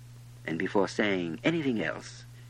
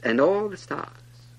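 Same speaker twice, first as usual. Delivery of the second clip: plain reading voice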